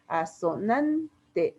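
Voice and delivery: ASONANTE muy lento, por sílabas, alargando NAN; sílaba tónica marcada. Shown as a demonstration. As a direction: separa A-SO-NAN-TE y sostén la segunda A. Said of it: "la sílaba tónica está en la segunda A". (silabeo lento de ASONANTE con acento en NAN)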